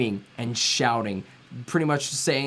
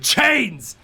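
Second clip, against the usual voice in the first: sharp shouted command, pitched up; yelled order; 9 semitones higher, 4 dB louder than usual. Acted. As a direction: bark the order at the wizard. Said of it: "He yells at the wizard".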